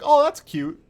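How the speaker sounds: condescending delight before the kill; amused Oryx. Retold or imitated. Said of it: "And Oryx is like".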